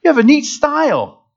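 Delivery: high-pitched, comic recruiting pitch; coaxing coach. Retold or imitated. Coach luring a soccer player onto football team, coaxing. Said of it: "the coach came and said".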